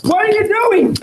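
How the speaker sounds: high terrified scream; frightened screaming. Real, not acted. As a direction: scream it in panic. Man screaming under restraint.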